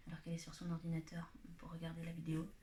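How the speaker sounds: breathy whisper under the breath; whispered aside. Real, not acted. Vlogger whispering an aside about her sister. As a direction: whisper it as a side remark.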